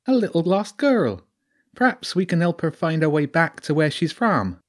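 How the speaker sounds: a sly lounging weasel with a rural Sussex lilt; high-pitched sneaky creature with a country twang. Acted. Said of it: "Its voice was high".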